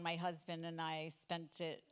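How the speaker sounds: ordinary delivery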